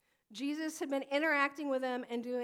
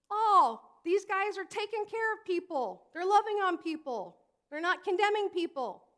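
higher, louder, bright praise; admiring onlookers; 6 semitones higher, 7 dB louder than usual. Pretend. Preacher voicing admiring onlookers, louder and higher. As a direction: gush about the good leaders. Imagined people praising the Pharisees.

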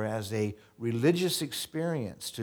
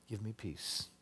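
soft, falling give me peace; hushed plea; 12 dB quieter than usual. Retold or imitated. Pastor voicing David at bedtime, softly pleading. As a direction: breathe it as a quiet plea. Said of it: "And he says".

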